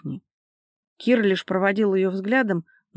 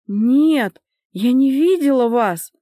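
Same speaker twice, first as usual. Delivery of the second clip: loud, higher-pitched pleading denial; tearful protest; 5 semitones higher, 7 dB louder, slower than usual. A narrator's reading. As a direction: defend yourself through tears, voice raised. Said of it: "сквозь слёзы оправдывалась Надежда". (оправдываться сквозь слёзы, громко)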